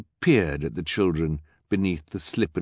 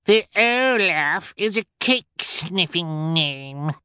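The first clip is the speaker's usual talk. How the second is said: put-on much higher, bratty child's voice; snotty little girl jeering; rude spoiled girl; 10 semitones higher than usual. A narrator's reading. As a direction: sneer it like a spoiled brat. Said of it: "cried a rude voice".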